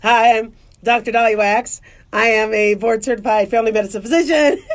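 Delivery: low, formal self-introduction. Shown as a demonstration. Doctor introducing herself to a patient, mock-serious low voice. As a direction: put on a low professional doctor voice. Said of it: "usually it's like".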